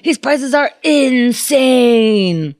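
high pitchman, stretching the last word out; Crazy Eddie. Acted. Salesman ending his commercial, manic and drawn-out. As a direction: sign off like a crazed TV salesman. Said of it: "would always end his commercials with".

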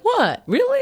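a teasing imitation of shocked disbelief; mock disbelief. Cohost mimics Keith reacting to impressions, squeaky mock disbelief. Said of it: "And you're like".

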